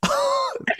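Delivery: sudden squeaky laugh; high burst of laughter. Real, not acted. Laughing at her answer.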